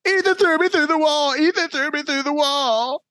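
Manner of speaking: very high-pitched mock kid wail; tattling little brother. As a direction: imitate a little kid running to tell on you. Little brother tattling while running downstairs.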